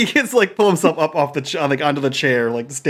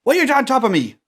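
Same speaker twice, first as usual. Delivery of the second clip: high-pitched, aggrieved outburst; indignant protest; 7 semitones higher than usual. Acted. Dazed man waking under his companions, high indignant protest. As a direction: demand answers in bewildered annoyance.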